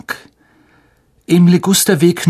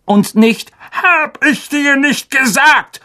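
higher, slowed raging uncle; 14 semitones higher, slower than usual. Acted. Uncle raging at Harry across the table, higher. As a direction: rant with spit flying. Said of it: "tobte sein Onkel".

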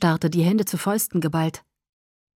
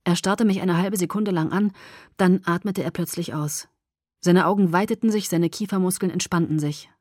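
ordinary delivery, nothing marked

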